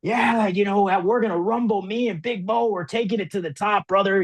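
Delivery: high, pumped-up wrestler cutting a promo; wrestling promo voice. Retold or imitated. Explaining the tone not to use on camera, hammed-up wrestling promo. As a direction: ham it up like a pro wrestler hyping a match. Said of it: "You're not like".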